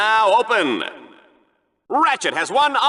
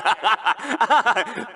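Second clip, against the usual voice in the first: laugh. Acted. Laughing at his own joke.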